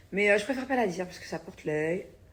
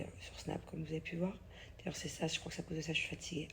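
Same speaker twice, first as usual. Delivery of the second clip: whisper; 10 dB quieter than usual. Real, not acted. Tired after crying, confiding.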